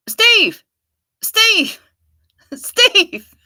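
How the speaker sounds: loud high shout, falling at the end; shouting a name. Pretend. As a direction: yell the name across a distance.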